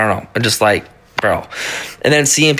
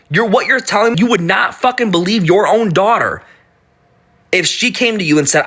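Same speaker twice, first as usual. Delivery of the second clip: ordinary talk, nothing marked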